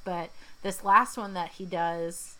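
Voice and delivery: ordinary talk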